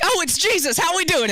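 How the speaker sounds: high-pitched excited fan outburst; starstruck nerd. Acted. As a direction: gush like a starstruck little nerd. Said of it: "little nerd me was like".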